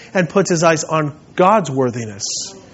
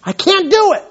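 Paul's conviction; 11 semitones higher than usual. Retold or imitated. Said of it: "fully, wholly convinced".

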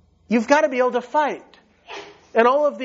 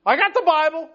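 mock helpless; 8 semitones higher than usual. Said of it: "you're gonna say".

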